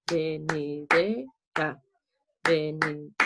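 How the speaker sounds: "venidera" slowly beaten out, ve-ni-DE-ra; syllable by syllable. Shown as a demonstration. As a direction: chop "venidera" into separate low, slow beats. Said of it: "si lo hacemos así".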